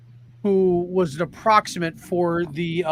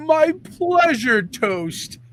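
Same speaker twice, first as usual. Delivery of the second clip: slow, much higher mock Pinhead; a hammed-up, drawn-out villain line; mocking the cartoon Pinhead, slow and high; Pinhead impression; 10 semitones higher, much slower than usual. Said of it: "He's like".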